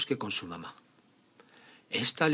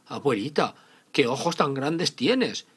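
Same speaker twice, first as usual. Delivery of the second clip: put-on little girl voice; 6 semitones higher, 8 dB louder than usual. Caperucita questions grandma.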